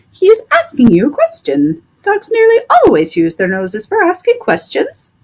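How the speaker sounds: high, chirpy parrot explaining; a clever parrot setting the doctor straight. Acted. Parrot Polynesia explains to the doctor, high parrot voice.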